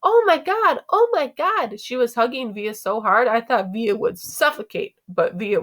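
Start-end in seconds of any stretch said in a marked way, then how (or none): 0.0-1.8 very high excited squeal; excited scream. A narrator's reading. Mom hugging Via so hard, excited screaming. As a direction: gush it in high bursts. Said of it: "Mom was screaming with excitement".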